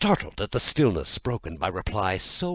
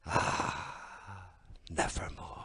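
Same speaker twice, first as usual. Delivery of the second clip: whispered, much softer lament; sorrowful sigh; 7 dB quieter, slower than usual. A narrator's reading. Narrator mourns Lenore in a whisper. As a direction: breathe it out in grief.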